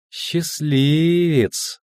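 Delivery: high, very slow saccharine coo; sugary sweet. A narrator's reading. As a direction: stretch the word out with false sweetness. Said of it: "сладенько проговорила Цири". (сладенько, нараспев)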